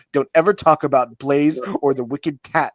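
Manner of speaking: loud warning breaking into laughter; hammed-up angry ultimatum; mock threat. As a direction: lay down the law, half laughing. Reading an angry threat aloud.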